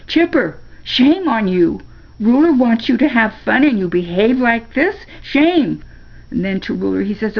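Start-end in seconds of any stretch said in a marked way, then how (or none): 0.1-5.8 high, loud scolding. A narrator's reading. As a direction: shame a disobedient dog, indignantly. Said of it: "scolds Chipper".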